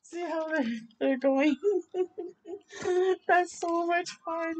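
ordinary delivery

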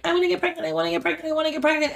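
high whiny chant, said over and over; mocking whiny wannabe mom. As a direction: mimic a whiny woman begging for a baby. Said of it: "When people are like".

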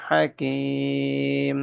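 আলিমুন Hakim drawn out very slowly; chanted tajweed model; slow clear recitation. Shown as a demonstration. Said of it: "তানবিনের পরে হা আসছে।".

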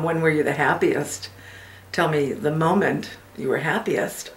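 soft, unhurried reverie with long pauses; reflective mother, wistful reminiscence. Acted. A mother tells her child of her happiest moment, wistful and hushed. Performs monologue from the Hours.

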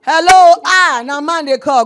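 loud relieved greeting as a caller finally comes through; calling out loud. Real, not acted. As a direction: shout into the phone line to reach the caller.

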